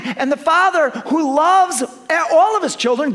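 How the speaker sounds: full-force preaching. Acted.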